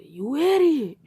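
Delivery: high, loud outburst; angry insult. Acted. Boy yells at his boasting rival, angry insult.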